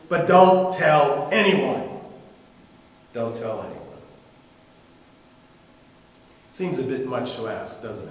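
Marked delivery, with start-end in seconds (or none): 0.1-1.9 slow, firm, a bit louder; firm warning. Acted. Jesus warns the healed man, firmly. 3.1-4.0 low, softer aside; quiet echo. Acted. Preacher repeats Jesus' order, quietly.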